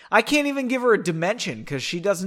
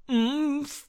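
mouth full; 9 semitones higher than usual. A narrator's reading. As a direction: hum through a mouthful. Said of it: "with a mouthful of stale snacks".

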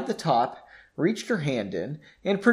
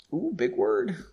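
taunting; 6 semitones higher, 4 dB quieter than usual. A narrator's reading. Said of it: "Henry taunted".

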